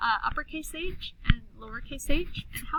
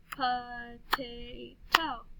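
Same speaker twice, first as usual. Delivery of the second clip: POTATO very slowly, po-ta-to; stretched-out "Po-ta-to"; clapped syllables; much slower than usual. Shown as a demonstration. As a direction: chant "Potato" in three long beats. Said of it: "then we'll clap the syllables".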